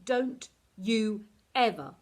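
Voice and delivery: slow, loud, cutting anger; angry. A narrator's reading. Olive cuts Esther off, slow loud anger. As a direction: cut her off, furious. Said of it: "I cut across her angrily".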